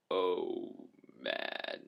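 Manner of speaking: low, sinking mock groan; retold dismay. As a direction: let the words sink in mock dread. His reaction to the inspection, retold.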